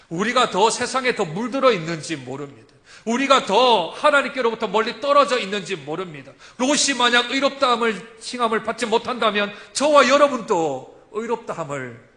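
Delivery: loud, rising sermon cry; full-force preaching. Acted. Preacher proclaiming to the congregation, loud. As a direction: preach it out to the whole church.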